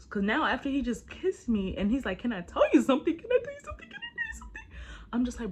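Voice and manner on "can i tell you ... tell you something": loud and high, climbing to a tiny squeak; exaggerated echo that shoots up into chipmunk pitch; escalating squeaky replay. He asks her after the kiss, replayed ever squeakier.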